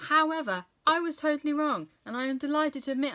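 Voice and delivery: high, loud delighted admission; excited. Real, not acted. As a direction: burst out happily, pitch jumping up. Delighted reversal of opinion.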